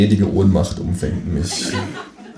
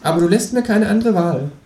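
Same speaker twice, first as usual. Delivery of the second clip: very high kangaroo interrogator; kangaroo, mock threatening. A narrator's reading. Kangaroo tormenting narrator for chocolates, high mock-menacing voice. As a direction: threaten solemnly in a high cartoon voice.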